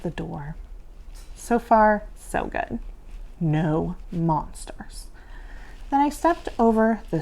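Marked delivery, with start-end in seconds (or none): 2.2-5.1 whispering. A narrator's reading. Sneaking through the dark house.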